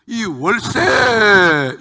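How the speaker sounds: loud, very slow, drawn-out yell for the rent; landlord's cracking yell. Retold or imitated. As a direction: bellow the rent demand like a fierce landlord. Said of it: "목소리가 막 두 갈래, 세 갈래 찢어지는데".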